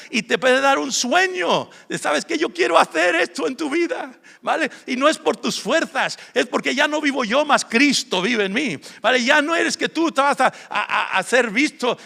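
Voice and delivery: loud, high, fervent preaching; preaching at full force. Real, not acted. Pastor exhorting the congregation, loud and fervent. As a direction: lift the church with it.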